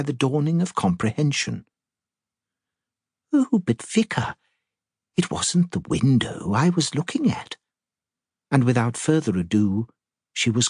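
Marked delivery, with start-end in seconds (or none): 3.3-7.6 put-on fluttery old lady. Miss Marple voiced.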